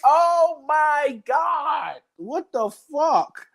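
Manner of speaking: high, slow disgusted yell; drawn-out shout. Real, not acted. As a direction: stretch out a horrified cry.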